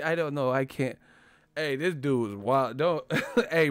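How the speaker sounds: normal talking voice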